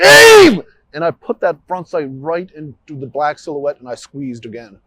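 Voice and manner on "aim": sharp full-voice shout, much higher and louder; yelled. Retold or imitated. Officer reliving yelling at himself mid-shooting, sudden shout. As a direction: bark the single word as a self-command.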